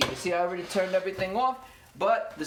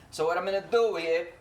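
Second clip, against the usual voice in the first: ordinary delivery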